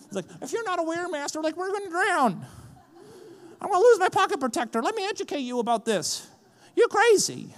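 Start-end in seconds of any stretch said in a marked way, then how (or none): 0.4-2.5 high, prissy know-it-all voice; total nerd. Pretend. Luke's disciples informing Jesus, high nerdy voice. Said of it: "made Luke sound like a total nerd". 3.6-7.5 high, fast, loud squeaky nerd panic; hammed-up nerd losing his cool; total nerd. Nerd fretting over his pocket protector, high squeaky panic. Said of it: "made Luke sound like a total nerd".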